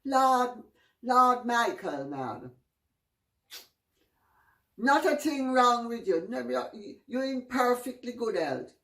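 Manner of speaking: cheerful Jamaican doctor, booming it out; Jamaican accent. Retold or imitated. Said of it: "I'm gonna do the Jamaican accent now".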